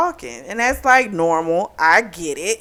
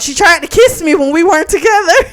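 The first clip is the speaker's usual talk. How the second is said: higher, louder sudden mock confession; retold blurt; 8 semitones higher, 6 dB louder than usual. Husband blurting to his wife, a hammed-up sudden outburst. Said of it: "he blurts out".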